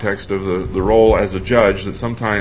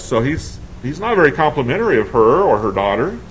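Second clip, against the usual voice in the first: usual speaking voice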